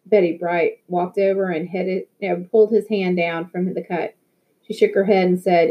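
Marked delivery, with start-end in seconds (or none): none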